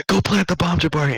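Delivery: egging on. Retold or imitated. Kids urging Jabari on, high excited shout. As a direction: cheer him on with a yell.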